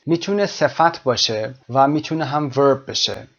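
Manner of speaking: usual speaking voice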